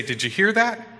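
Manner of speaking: a hint of a playful child; little son asking. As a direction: play the boy testing his dad. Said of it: "he'd say".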